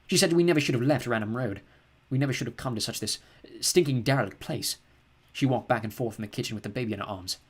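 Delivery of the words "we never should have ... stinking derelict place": ordinary delivery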